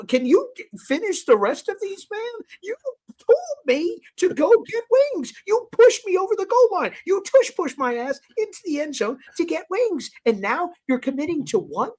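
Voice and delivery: high-pitched comic exasperation; indignant rant. Retold or imitated. Blaming friend who pushed him into wings, indignant. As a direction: plead and accuse, pitch jumping up in protest. Acted quarrel over uneaten wings.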